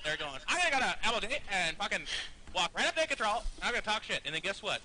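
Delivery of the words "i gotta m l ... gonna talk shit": mock rival player. Host acting out the rival's boast to needle him, mocking imitation. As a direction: puff up the rival's boast in a put-on voice. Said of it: "I don't talk like that".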